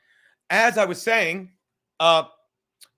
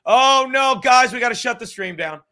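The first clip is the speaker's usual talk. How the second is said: loud hammed-up panic, higher; mock panic; 4 semitones higher, 7 dB louder than usual. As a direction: play a fake alarm to shut the stream down. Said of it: "I'm like".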